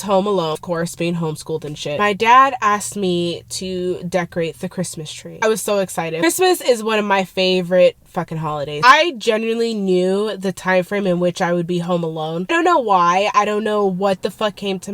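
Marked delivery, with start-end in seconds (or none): none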